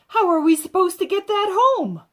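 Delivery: worried mother at the end of her rope; exasperated mom. A narrator's reading. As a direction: mom fussing over the dinosaur. Mother alarmed by dinosaur.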